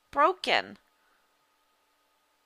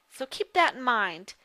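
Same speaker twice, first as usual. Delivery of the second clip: ordinary delivery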